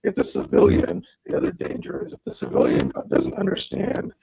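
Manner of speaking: crackly, broken-up headset audio; distorted mic cutting in and out; audio distorting. Real, not acted. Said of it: "your audio is distorting".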